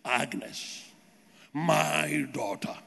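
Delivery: very low, slow, solemn divine voice; imagined voice of God. Acted. Imagined God addressing a waiting believer, deep solemn divine voice. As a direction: intone it deep and drawn out, mock-holy. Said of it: "waiting to hear a voice telling you".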